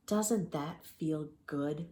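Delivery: very slow soothing coax. Acted. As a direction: coax him gently. Circus man coaxes.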